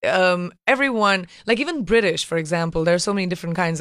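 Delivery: normal talking voice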